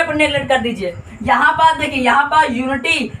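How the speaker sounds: usual speaking voice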